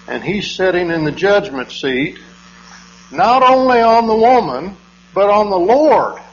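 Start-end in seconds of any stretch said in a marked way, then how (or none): 3.1-6.2 loud, higher, indignant preaching. Real, not acted. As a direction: thunder it from the pulpit. Preacher building to indignant peak.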